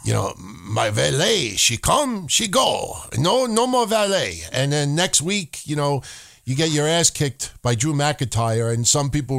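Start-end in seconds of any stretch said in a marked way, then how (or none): 0.0-4.4 a lightly put-on Japanese accent, broken English; mock Japanese-accented broken English as Sin Cara. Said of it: "I sound like a Japanese Sin Cara".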